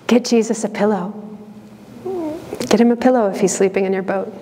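ordinary talk, nothing marked

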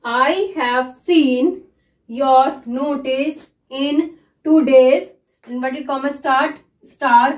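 very slow, word by word; dictating a shorthand passage slowly; slow dictation. Shown as a demonstration. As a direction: read it slowly so students can follow. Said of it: "मैं इसको किस तरह से read कर रही हूँ".

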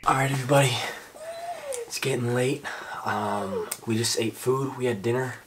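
soft, hushed, saying goodnight to camera; quietly winding down for the night; hushed bedtime voice. Acted. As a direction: keep it low so you don't wake anyone.

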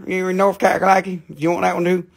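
high-pitched hometown Southern twang; North Carolina accent. Shown as a demonstration. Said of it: "in my hometown language here".

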